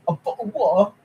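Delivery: fast, laughing British bottle of water; quick giggly British meme; mock British accent. Streamer rattling off the British meme, laughing, mock accent.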